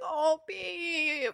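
soft, high, pitying coo; mock pitying. Driver to the deluded girl, mock pitying. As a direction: play him gently letting her down, small and high.

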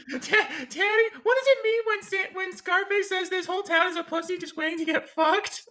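put-on high, squeaky child voice; little kid voice. Timmy asking daddy about Scarface, squeaky child voice. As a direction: play a naive little boy.

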